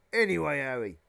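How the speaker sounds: hearty giant. Acted. Giant turns warmly to Harry.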